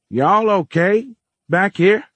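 put-on high, slow, drawn-out old-timer's call; slow roll. A narrator's reading. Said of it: "came on a slow roll".

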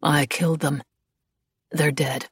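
understated, hollow confession; Reed, hollow. A narrator's reading. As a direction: say it emptily, in short separate statements. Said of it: "his voice hollow".

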